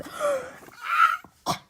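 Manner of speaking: huge high-pitched gasp; stifled scream. Real, not acted. Seeing the art with someone asleep, stifled scream. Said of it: "I can't, I can't scream".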